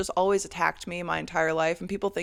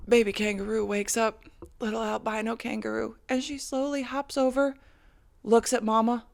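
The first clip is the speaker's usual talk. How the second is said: usual speaking voice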